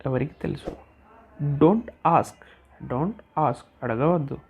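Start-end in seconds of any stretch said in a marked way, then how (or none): none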